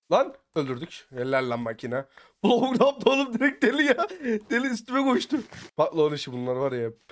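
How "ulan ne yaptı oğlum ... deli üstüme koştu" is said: high, loud words through laughter; incredulous, high-pitched laughing outburst; laughing in disbelief. Real, not acted. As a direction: crack up while exclaiming. Cracks up at player charging him.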